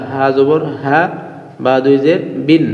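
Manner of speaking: ordinary delivery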